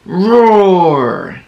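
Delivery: growly mock tiger roar; a playful, lightly growled roar. Shown as a demonstration. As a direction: roar like a friendly tiger for kids. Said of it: "Tigers say".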